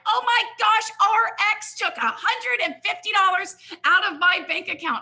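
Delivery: high, loud mock alarm; imitating upset caller. Mock upset customer calling about a bank charge, high and loud. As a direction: play the angry customer, shrill with alarm. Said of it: "And they're like".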